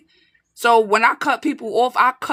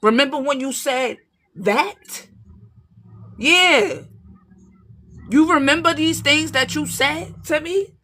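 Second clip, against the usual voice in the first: higher, pointed, accusing challenge; confronting the liar; 6 semitones higher, slower than usual. Retold or imitated. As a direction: throw their words back at them, higher. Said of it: "You gonna let their ass know".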